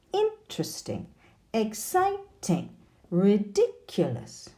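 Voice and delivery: "Interesting, exciting, ridiculous", each swooping up then down on its stressed syllable; rise-fall intonation. Shown as a demonstration. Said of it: "we use rise, fall intonation".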